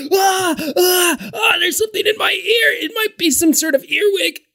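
high, whiny fake pain; high put-on whine about the ear; mock pained customer. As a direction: squeal it like a faker with a bug in his ear. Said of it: "I learned this from someone".

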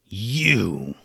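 low, drawn-out accusing word; angry. A narrator's reading. Bones recognizing the sisters who beat him, angry. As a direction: draw it out in restrained anger. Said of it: "his shock turned into anger".

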